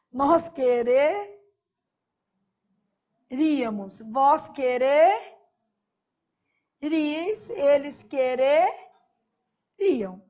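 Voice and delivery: quereríamos drawn out slowly; slow drill. Shown as a demonstration. Teacher finishing the conditional for the class, slow. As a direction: lay out each form slowly. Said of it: "Aqui eu pus um re".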